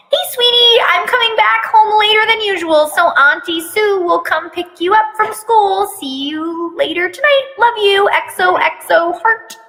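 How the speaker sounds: high, sweet mother reading out a text; mom's voice. Acted. Mom texting her son, high and sweet. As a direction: chirp it like a doting mom.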